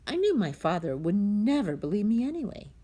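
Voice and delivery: ordinary delivery, nothing marked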